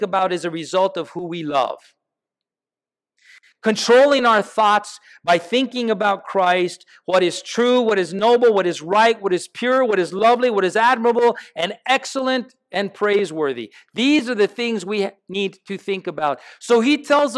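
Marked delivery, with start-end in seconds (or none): 3.6-13.7 preaching. Acted. Builds a list of virtues.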